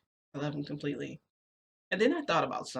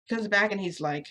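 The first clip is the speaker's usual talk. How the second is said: normal talking voice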